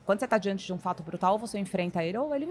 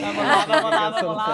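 laughing cheer; 3 semitones higher, 10 dB louder than usual. Real, not acted.

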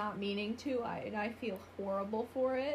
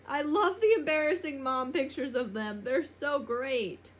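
gushing; 3 semitones higher, 10 dB louder, slower than usual. Real, not acted. Gushing over mom photos.

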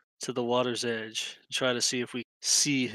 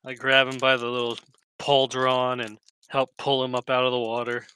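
usual speaking voice

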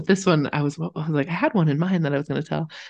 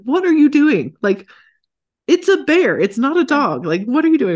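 high, loud incredulous scolding; mock disbelief; 9 semitones higher, 5 dB louder than usual. Owners scolding the dog at the bear, high mock disbelief. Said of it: "we're like".

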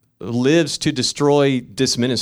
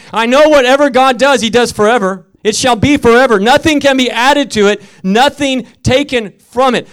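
louder, higher repeat of the verse; scripture proclaimed; 7 semitones higher, 8 dB louder than usual. Shown as a demonstration. Preacher repeating the verse to the congregation, louder. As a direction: proclaim it even harder the second time. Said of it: "I just want to say that again".

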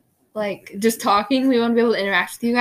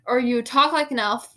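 ordinary talk, nothing marked

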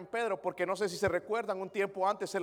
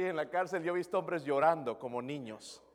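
normal talking voice

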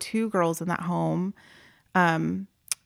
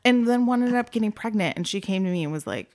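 ordinary talk, nothing marked

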